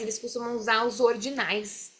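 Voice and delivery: ordinary talk, nothing marked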